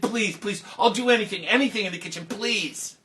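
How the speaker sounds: high, loud begging; desperate pleading. Acted. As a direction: plead for any work at all. Girl begs guard.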